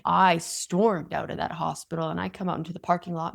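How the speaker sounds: usual speaking voice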